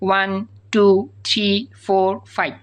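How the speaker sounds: each digit said apart with pauses; one by one. Shown as a demonstration. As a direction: count them out one at a time. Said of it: "একটা একটা করে উচ্চারণ করতে হবে".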